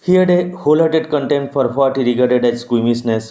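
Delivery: slow, measured reading of the English passage; slow text reading. Acted. Teacher reading the English text to students, slow and measured. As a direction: read each word out deliberately for the class.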